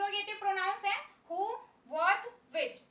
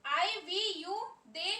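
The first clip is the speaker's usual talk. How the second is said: slow dictation; much slower than usual. Shown as a demonstration. Teacher listing pronouns for copying.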